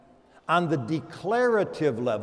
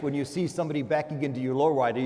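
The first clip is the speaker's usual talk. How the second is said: usual speaking voice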